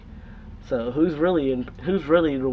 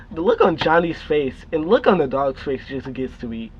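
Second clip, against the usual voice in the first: ordinary talk, nothing marked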